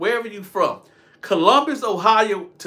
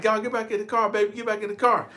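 imitated pleading girlfriend; 6 semitones higher, faster than usual. Voices the girl pleading.

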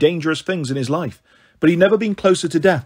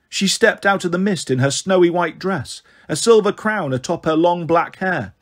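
usual speaking voice

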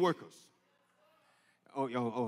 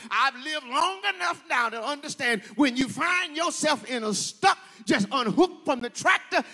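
higher, louder whooping sermon cadence; preaching at full force; 6 semitones higher, 6 dB louder than usual. Acted. Preacher urging the congregation, shouting louder and higher. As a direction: build and hammer each line home.

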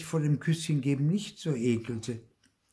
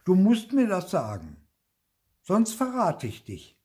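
put-on high, raised-voice threat from a child; shouting demand; 8 semitones higher, 5 dB louder than usual. A narrator's reading. Sister threatens to betray the hiding place, shouting. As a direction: insist loudly, pitched well up. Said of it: "Wenn sie so schrie".

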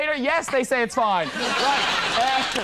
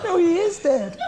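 giggling through the blunt line; laughing repeat; 9 semitones higher than usual. Pretend. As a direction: say it while cracking up. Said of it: "repeating the joke quote".

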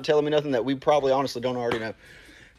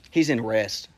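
normal talking voice

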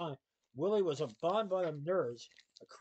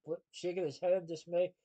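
ordinary delivery

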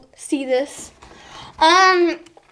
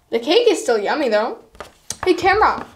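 ordinary delivery

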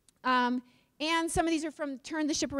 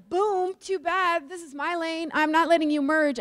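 slow, higher, defiant driver blocking a merge; mock road-rage driver; 4 semitones higher, 4 dB louder than usual. Speaker acts out an anonymous Utah driver refusing a merge, hammed-up defiance. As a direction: gloat over the car that can't merge in. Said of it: "we're like".

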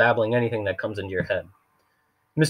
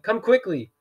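mock alarm; 11 semitones higher, slower than usual. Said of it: "His voice was rough and low".

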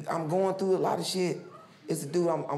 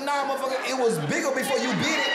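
higher, fast indignant comeback; mock angry retort; 7 semitones higher, 4 dB louder, faster than usual. Teen arguing with Champ over bitten crack, quick and indignant. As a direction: snap back at him, quick and high.

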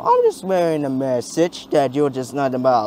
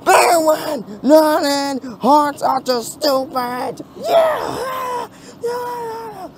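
crowd shouting; 13 semitones higher, 4 dB louder, much slower than usual. Acted.

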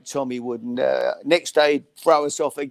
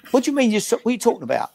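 Barry's indignant what do you mean, raised; outraged disbelief; 8 semitones higher, much faster than usual. Retold or imitated. Barry Fry on the phone, stunned by the signing, raising his voice in disbelief.

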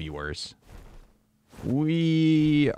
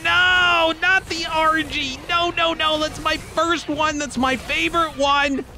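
anguished wailing; 13 semitones higher than usual. Real, not acted. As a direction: plead as your favorite pet dies. Watching favorite pet die.

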